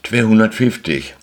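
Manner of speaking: usual speaking voice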